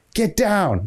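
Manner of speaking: high mock yell; retold scream. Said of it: "I start screaming at him".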